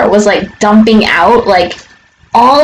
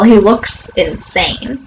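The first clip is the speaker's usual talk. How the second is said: normal talking voice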